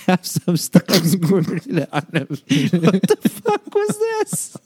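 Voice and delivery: losing it laughing, words slowed and squeaky; cracking up. Real, not acted. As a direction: read it through helpless, high-pitched laughter. Cracks up reading aloud.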